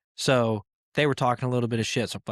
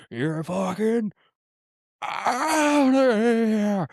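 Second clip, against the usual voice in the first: drawn-out, high, wailing "outta here" with a break in the voice; mock umpire howling the ejection, much higher and slower; umpire ejection call; 9 semitones higher, 3 dB louder, much slower than usual. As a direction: stretch it out like a hammed-up ump throwing a player out. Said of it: "I'd be like".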